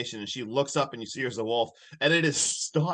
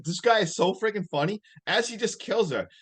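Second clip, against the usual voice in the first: ordinary talk, nothing marked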